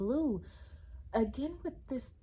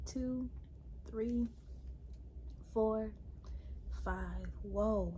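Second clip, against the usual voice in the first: ordinary talk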